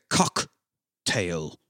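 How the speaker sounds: "cock" set apart, then a pause before "tail"; stress on cock. Retold or imitated. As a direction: hit "cock" hard, drop softly onto "tail". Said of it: "They emphasize cock so much".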